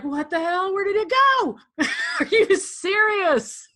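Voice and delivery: rising, incredulous, half laughing; hammed-up what-the-hell disbelief; mock outrage. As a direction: act baffled that it vanished. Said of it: "It's like".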